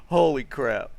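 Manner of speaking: normal talking voice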